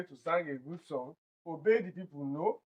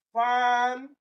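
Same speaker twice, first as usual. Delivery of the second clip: "fan" of phantom held long on a raised pitch; stressed first syllable; 5 semitones higher than usual. Shown as a demonstration. Teacher isolates the stressed syllable, drawing it out. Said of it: "It is the first syllable".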